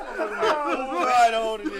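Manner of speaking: slow, loud, high macho promo; drawn-out wrestler growl; wrestling promo. Pretend.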